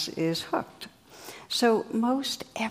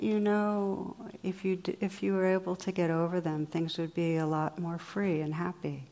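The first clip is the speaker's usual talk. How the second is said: guided meditation; 4 dB quieter than usual. Acted. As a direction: guide them gently inward. Guided reflection, eyes closed.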